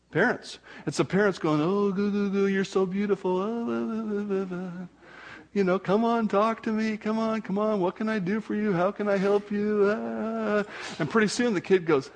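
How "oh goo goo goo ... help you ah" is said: put-on parent baby talk. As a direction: coo at the baby to coax it.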